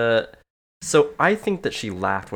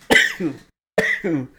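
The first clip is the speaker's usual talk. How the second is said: coughing, stuffed nose; coughing fit; 7 dB louder than usual. Real, not acted. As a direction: talk through a cough. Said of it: "blow your nose so much".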